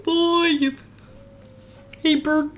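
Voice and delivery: put-on puppet voice; goofy puppet impression; Ernie. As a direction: call to a pal as a puppet. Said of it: "speak in the Ernie voice".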